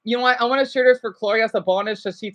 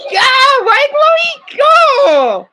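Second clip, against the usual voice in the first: excited shout; 8 dB louder, much slower than usual. Real, not acted. Cheering the cartoon on.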